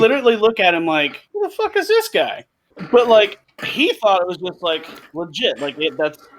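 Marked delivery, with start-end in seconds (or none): 1.3-2.4 high, incredulous squeak of disbelief; baffled disbelief. Retold or imitated. Partner staring at Seagal's slang, voiced as high incredulous disbelief. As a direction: react like a stranger just talked nonsense at you.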